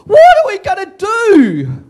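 high, loud, slowed shout; hammed-up desperate shout; panicked shout. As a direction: yell it in mock panic. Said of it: "You can hear it".

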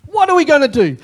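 high, loud cry of panic; the frightened servant crying out to Elisha; panicked servant. Acted. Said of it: "the servant just turns around and goes".

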